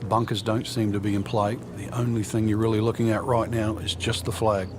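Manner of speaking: ordinary delivery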